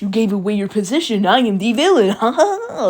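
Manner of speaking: loud, swooping gloat into a high cackle; hammed-up villain. Classmate playing villain catches the girl hiding, gloating. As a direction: crow it like a cartoon evil mastermind. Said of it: "Ida's like".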